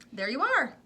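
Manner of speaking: high, loud triumphant call; triumphant cry. A narrator's reading. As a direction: crow it out in triumph. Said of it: "he cried triumphantly".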